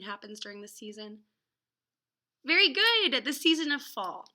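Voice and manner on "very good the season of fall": put-on loud, high very good for a child's answer; much louder enthusiastic classroom praise. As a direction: cheer the right answer like a teacher. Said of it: "praising imagined child answer".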